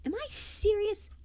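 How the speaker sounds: can't believe what she hears; disbelieving. Real, not acted. Cringing at own old show.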